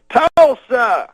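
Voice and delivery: Cosby baiting the college crowd, high and loud; crowd-baiting shout. Retold or imitated. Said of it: "Then he goes".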